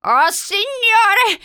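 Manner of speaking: high, slow, drawn-out chant; intoned invocation. A narrator's reading. Old woman invoking the Lord at the door, intoning. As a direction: intone it like a plea to heaven. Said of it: "intonò".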